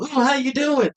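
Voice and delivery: high, sing-song mock greeting; fake sweet greeting. Mocking relatives who greet him fakely, high and sugary. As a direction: mimic a phony cheerful hello.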